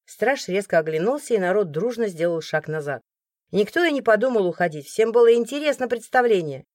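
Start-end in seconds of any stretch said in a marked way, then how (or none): none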